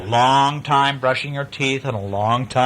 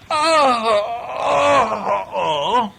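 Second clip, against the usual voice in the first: stretching groan; 10 semitones higher, much slower than usual. Acted. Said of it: "She went".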